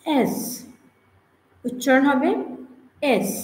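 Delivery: "এস" crisp, held hiss; correct S. Shown as a demonstration. Teacher models the letter S as "এস". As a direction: model "এস" slowly. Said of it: "উচ্চারণ যদি এরকম হয়".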